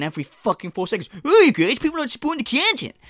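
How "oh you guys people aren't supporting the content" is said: high, loud, whiny mock of the streamer. Mocking the streamer's whining to his chat, high and whiny. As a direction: whine it like a spoiled streamer. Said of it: "listen to you whine".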